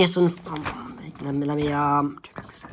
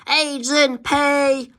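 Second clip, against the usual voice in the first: drawn-out, much higher call of the name; 10 semitones higher, much slower than usual. Acted. Calling after Agent P as he leaves, high and stretched.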